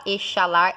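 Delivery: "exalar" said as e-sha-LAR; wrong SH pronunciation. Shown as a demonstration. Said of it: "Você não fala exalar".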